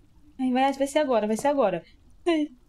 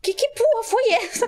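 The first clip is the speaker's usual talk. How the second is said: high, loud shriek of fright; startled scream; 7 dB louder than usual. Real, not acted. Gamer jolted by a jump scare, shrieking.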